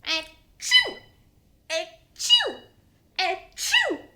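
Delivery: loud high sneezes, one after another; big cartoon sneeze, thrice; ghost baby sneezes. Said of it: "she goes like this".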